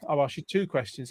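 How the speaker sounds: normal talking voice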